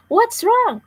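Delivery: high, loud alarmed question; boy calling down to his trapped sister; yelled. A narrator's reading. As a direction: shout down into the hole. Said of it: "James yelled".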